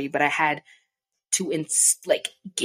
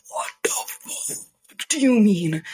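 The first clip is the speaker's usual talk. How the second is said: slow, raised-pitch outraged what; stunned, pitched-up disbelief; shocked disbelief; 5 semitones higher, 3 dB louder, slower than usual. Retold or imitated. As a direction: drag out the shock. Shocked reaction to warning.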